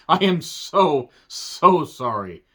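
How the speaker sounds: "so" stretched slowly, twice; emphatic apology. Real, not acted. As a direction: lean long and hard on each "so". Mock apology for his bad accent.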